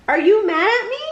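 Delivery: blurted. Acted.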